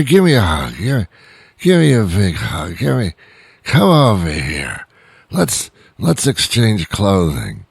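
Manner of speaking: hammed-up drunk pushing for hugs and kisses; mock drunk buddy, gushing and pleading; denying nice drunk. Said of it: "when you say to them".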